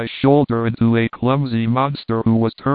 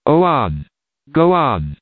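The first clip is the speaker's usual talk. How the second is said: understated howl of a pursuing mob; howled urging; 2 semitones higher than usual. A narrator's reading. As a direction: call it out like a restrained howl. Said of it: "they howled".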